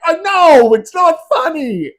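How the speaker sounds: very high squeaky giggling reply; laughing admission. Retold or imitated. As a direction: give in to the giggles. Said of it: "And he's like".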